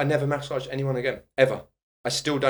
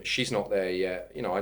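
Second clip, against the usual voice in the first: ordinary talk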